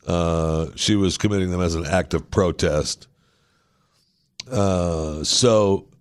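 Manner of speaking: ordinary delivery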